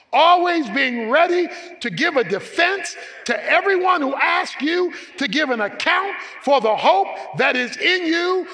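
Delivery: loud, high preacher's call; preaching at full force. Acted. Pastor to congregation, preaching loud and high. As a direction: proclaim it to the congregation.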